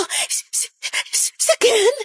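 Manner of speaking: faint, trembling sob; weak crying stammer. Acted. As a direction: barely get it out through tears. Hinang-hina ang boses ko noon. (nanghihinang umiiyak na boses)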